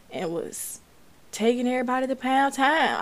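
ordinary talk, nothing marked

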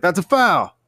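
a lightly acted referee announcing a foul; clueless referee call. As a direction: blow the call like a bad ref. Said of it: "And he's like".

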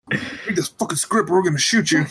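hammed-up hostage taker giving orders; mock menacing captor. Mock hostage-style threat to developer.

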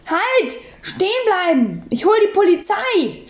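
high, urgent yell; shouting after them. A narrator's reading. Lürcher shouts after the fleeing pair. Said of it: "schrie Herr Lürcher ihnen nach".

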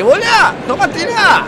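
high, loud mock shout at mother; mock angry yell. Player yelling at his mother, mock loud shout. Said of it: "le dice".

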